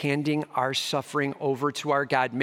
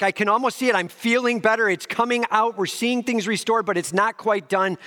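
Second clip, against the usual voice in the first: hopeful excitement; 7 semitones higher than usual. Retold or imitated. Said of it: "You're like".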